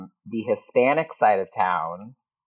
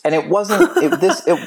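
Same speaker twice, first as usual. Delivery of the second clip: laughing; 10 semitones higher than usual. Real, not acted.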